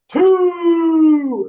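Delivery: loud, high sustained holler; drawn-out scoring call. Retold or imitated. Mimicking a match-side points call, drawn-out high shout.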